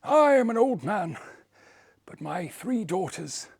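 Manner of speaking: put-on high, laughing old man. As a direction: croon it like a proud father. Old man offers daughters.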